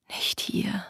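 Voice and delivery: barely audible anxious whisper; whispered thought. Acted. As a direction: whisper it to yourself like a plea. Ihr ängstlicher stummer Gedanke. (ängstlich geflüstert)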